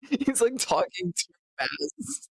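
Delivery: high-pitched, slowed by real laughter; still laughing. Real, not acted. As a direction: squeeze the words out between laughs. Still laughing about the played clip.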